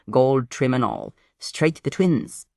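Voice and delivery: ordinary delivery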